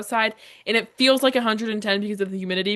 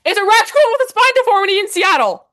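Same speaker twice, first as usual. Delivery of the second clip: much higher and louder, fed-up yell; exasperated outburst; 12 semitones higher, 8 dB louder than usual. Retold or imitated. Answering the surfer dad's conspiracy, exasperated yell. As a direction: shout it out in disbelief.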